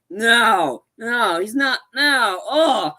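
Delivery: loud, high cries of protest; imitated screaming. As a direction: cry out like a horrified audience. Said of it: "like, screaming".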